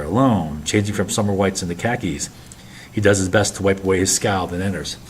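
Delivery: usual speaking voice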